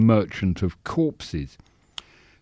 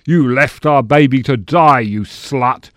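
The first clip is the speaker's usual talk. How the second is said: loud, raised-pitch melodramatic accusation; stage fury; 4 semitones higher, 8 dB louder than usual. Acted. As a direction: accuse like a horror-play killer, dropping on the last words. Stage villain accuses victim in horror play.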